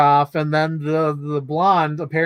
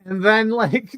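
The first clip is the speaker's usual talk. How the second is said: normal talking voice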